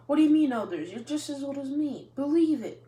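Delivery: loud, high indignant retort; boy shouting back at his teacher, indignant. Acted. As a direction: fire it back at him loud. Naruto retorts about being called young.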